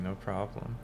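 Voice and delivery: ordinary delivery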